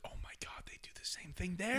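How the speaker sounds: hushed, whispered mock astonishment; retold reaction in an astonished whisper; whispered amazement. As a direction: whisper it like a stunned discovery. Retelling amazed reaction to a video.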